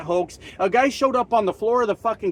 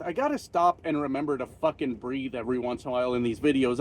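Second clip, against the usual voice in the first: quiet; 5 semitones lower, 6 dB quieter than usual. Real, not acted. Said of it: "I gotta catch my breath".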